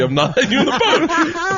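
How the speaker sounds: high teasing refusal with a laugh; laughing taunt. Retold or imitated. Driver taunting Bobby in the water, laughing. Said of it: "I go".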